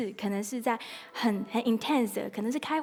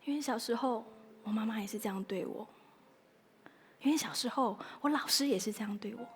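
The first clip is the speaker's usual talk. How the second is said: softer, hushed hurt confiding; sad confession; 7 dB quieter than usual. Acted. Mr Sad telling his childhood hurt, softly. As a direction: let the sadness speak quietly. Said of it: "难过先生就会告诉你".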